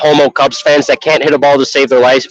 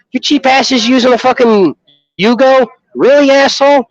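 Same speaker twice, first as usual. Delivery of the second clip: higher, mock outraged jab at a stranger; mock indignant rant; 9 semitones higher than usual. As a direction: toss it off as a hammed-up insult. Acted gun argument with stranger.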